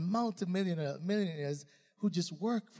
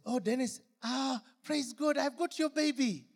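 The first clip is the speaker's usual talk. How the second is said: a touch higher, gushing; imitating excited woman; 7 semitones higher than usual. Grateful mother greets Dennis, lightly voiced gushing woman. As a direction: gush like a thankful mother. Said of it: "This woman says".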